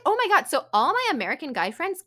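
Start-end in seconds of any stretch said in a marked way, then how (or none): 0.1-0.5 high, loud horrified gasp of words; shocked. Retold or imitated. Groped in a club, retelling it with shock. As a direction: recoil in shock. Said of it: "I was like".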